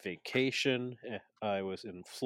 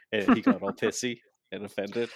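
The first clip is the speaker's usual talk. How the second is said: normal talking voice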